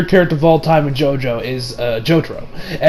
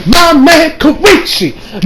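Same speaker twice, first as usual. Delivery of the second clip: high, drawn-out fan yell; real excited whooping; hyped cheering; 11 semitones higher, 3 dB louder, slower than usual. Real, not acted. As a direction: cheer like a fan at a clutch play. Hyping a favourite character.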